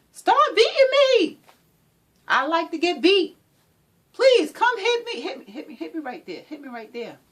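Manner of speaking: high, loud mocking voice of a victim begging; mock abuse victim. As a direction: sarcastically play someone asking to be hit. Mocking victim asking to be hit.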